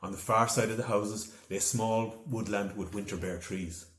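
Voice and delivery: ordinary delivery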